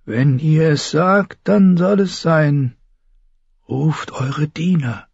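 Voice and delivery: slow, high character voice; ceremonial, drawn-out sorceress; solemn. A narrator's reading. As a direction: agree gravely, word by word. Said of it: "Die Maegi nickte feierlich".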